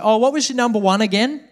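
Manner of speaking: high, loud singsong teacher asking again; sweet teacher voice. Retold or imitated. Teacher asking the class to repeat, high bright query. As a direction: chirp it out like an oblivious teacher. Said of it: "she's like".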